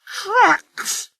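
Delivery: Trucks! flung out very high and indignant; a proud engine refusing trucks; scornful snort. A narrator's reading. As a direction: scoff at Trucks! in outrage. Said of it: "snorted Sir Handel".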